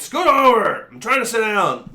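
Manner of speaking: loud, high mock yell; cranky old man. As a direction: bark it like a crabby old guy. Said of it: "he's just an old, cranky".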